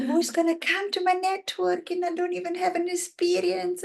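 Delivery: high-pitched imitation of an inner worrier; self-doubting voice. Coach replays her doubts about networking, high fretting voice.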